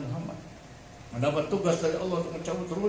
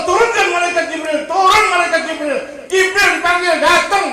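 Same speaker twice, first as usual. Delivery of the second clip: high, shouted sermon delivery; full-force preaching; 13 semitones higher, 13 dB louder than usual. Real, not acted. Preacher tells of the Prophet calling down Jibril, full-force preaching. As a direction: cry it out to the whole congregation.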